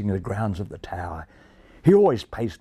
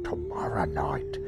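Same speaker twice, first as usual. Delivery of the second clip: low conspiratorial whisper; whispering magpie; 8 semitones lower, 8 dB quieter than usual. A narrator's reading. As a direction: whisper the plot like a thief.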